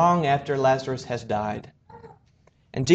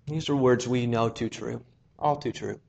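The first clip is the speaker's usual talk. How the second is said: usual speaking voice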